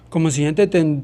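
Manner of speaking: ordinary talk, nothing marked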